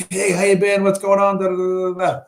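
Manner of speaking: high-pitched mock greeters, held on one high note; imitated eager greeting. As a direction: mimic people crowding round to say hi. Imitating eager greeters who surround him.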